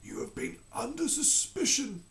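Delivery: sorrowful king breaking news. Acted. The King delivers bad news.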